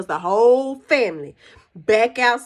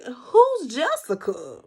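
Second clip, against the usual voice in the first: drawn-out, slow puzzled question; puzzled reaction; 2 semitones higher, slower than usual. Retold or imitated. Viewers reacting to an unknown name, puzzled. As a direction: stretch it out in mock bewilderment.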